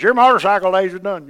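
wife overruling her husband, loud and final; wife's stern order. Retold or imitated. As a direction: bark it like the boss of the house. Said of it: "my wife goes".

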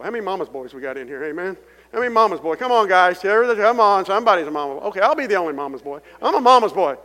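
loud, high call to the crowd; rally the room, shouting it out; preaching call-out. Acted. To the congregation, loud rallying call. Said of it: "calls on the congregation".

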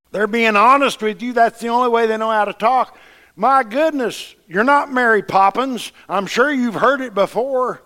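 loud, high fervent preaching; preaching at full force. Real, not acted. Preacher retelling church stories to the congregation, loud and fervent.

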